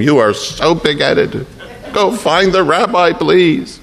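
pleading wife, pitch climbing high; exasperated wife. Acted. Wife begging her stubborn husband to find the rabbi, exasperated. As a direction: nag him to go, drawn-out then rising. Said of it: "His wife may have been saying to him".

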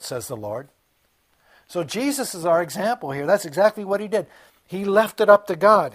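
fervent sermon climax; preaching at full force. Acted. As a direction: drive it home from the pulpit.

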